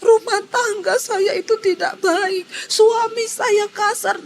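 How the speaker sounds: high, tearful voice breaking into sobs; weeping through the words, lightly acted; mother crying on the phone. Retold mother confides to the teacher by phone, crying high and tearful. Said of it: "Langsung mamanya ini nangis".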